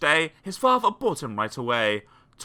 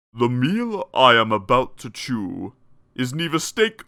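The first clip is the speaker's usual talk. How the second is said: menacing lion; 2 semitones lower, 5 dB louder, slower than usual. Acted. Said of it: "He came up very close and said".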